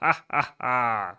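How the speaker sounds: slow low villain laugh; evil laugh. Acted. Villain gloats alone.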